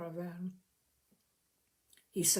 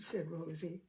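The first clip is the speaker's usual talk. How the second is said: usual speaking voice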